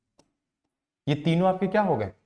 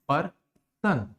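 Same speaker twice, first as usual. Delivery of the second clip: Person in two parts, पर, then सन; slow person with a gap between syllables; much slower than usual. Shown as a demonstration. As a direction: break person into पर and सन. Splitting a word into syllables.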